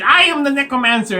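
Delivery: high, loud mock-dramatic proclamation; villain kid reveal. Little kid reveals himself to adventurers, hammy triumph. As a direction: announce it like a hammy villain child. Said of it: "And the little kid".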